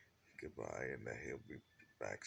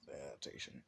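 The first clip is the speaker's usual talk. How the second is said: ordinary delivery, nothing marked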